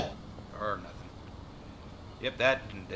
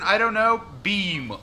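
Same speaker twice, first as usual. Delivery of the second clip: ordinary delivery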